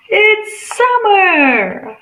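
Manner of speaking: excited reveal. Acted. Teacher revealing summer to the class with delight. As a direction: sing out the answer with delight. Teacher announces the next season.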